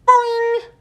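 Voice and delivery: high loud twangy boing; frog boing. Retold or imitated. The frog lunging at the hamster, loud twangy boing. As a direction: twang like a broken string.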